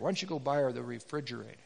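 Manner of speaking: same gentle question, corrected; soft voice of God. Acted. God gently prompting the divorced man, low and soft. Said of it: "it was a refrigerator".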